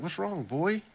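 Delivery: high, cooing talk to a pet; mock Lassie owner. Teasing the scared rabbit like Lassie, high cooing. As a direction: ham it up like talking to a dog. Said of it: "He's like".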